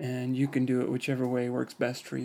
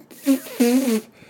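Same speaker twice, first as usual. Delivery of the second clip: tight lip buzz; 10 semitones higher, 9 dB louder than usual. Shown as a demonstration. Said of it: "you tighten your lips and".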